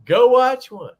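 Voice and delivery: high, loud repeat of go watch one; emphatic shout. Real, not acted. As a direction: bark the order as a challenge.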